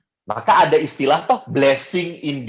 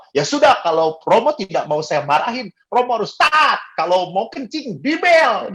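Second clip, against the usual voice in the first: scolding doctor; 7 semitones higher than usual. Retold or imitated. Doctor scolds priest laughingly.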